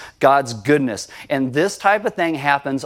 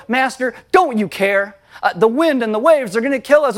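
panicked plea; 6 semitones higher, 3 dB louder than usual. Acted. Panicked disciples in storm.

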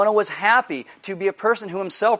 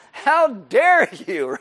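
slow, drawn-out mock indignation; a hammed-up how dare you; mock outrage; 4 semitones higher, 4 dB louder, much slower than usual. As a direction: stretch it out in pretend outrage. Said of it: "mock outrage at God's grace".

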